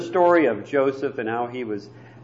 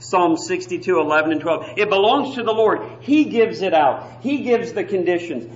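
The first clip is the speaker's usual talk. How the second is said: ordinary talk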